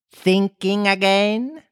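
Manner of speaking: high, slow, put-on voice; drawn-out, nosy character question; prying Duchess. A narrator's reading. Duchess questioning Alice in story.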